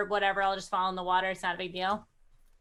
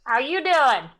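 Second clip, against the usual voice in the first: loud, raised-pitch hail; calling out; 5 semitones higher, 5 dB louder than usual. Acted. Player hailing a stranger on a far ship, calling out. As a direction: hail someone across a distance.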